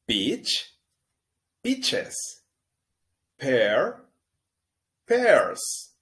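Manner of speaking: "peaches" rising high, "pears" loud and high; model pronunciation. Shown as a demonstration. Teacher leading repeat-after-me drill. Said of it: "Repita después de mí".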